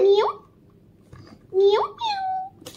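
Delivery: squeaky mock kitten meows; playful high meow back at the toy cat. Copying the toy cat's meows.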